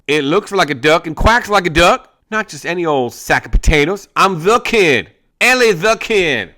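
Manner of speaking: high, loud bragging; boastful outlaw. Acted. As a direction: puff up and brag. Gang and Ellie trade boasts.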